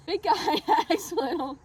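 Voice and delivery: laughing. Real, not acted. Laughing while telling tall tale.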